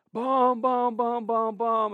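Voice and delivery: loud, high mock thunder boom; imitating thunder.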